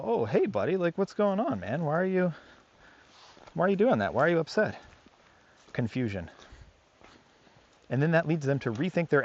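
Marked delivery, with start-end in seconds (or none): 0.0-4.8 higher, casual friendly chatter to the dog; friendly, unbothered. Retold or imitated. Handler calmly greeting an aggressive dog, higher and friendly. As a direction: act easygoing and puzzled, not threatened. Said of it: "They don't expect you to go".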